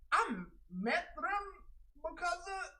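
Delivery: high, slow mocking voice of a hanger-on; mock whiny friend. Streamer mocking a follower's excuse in a high whiny voice.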